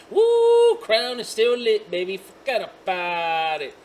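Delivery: loud high woo of delight; excited whoop. Real, not acted. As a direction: cheer like you nailed it. Excited hit from vape coil.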